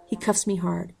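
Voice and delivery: ordinary delivery, nothing marked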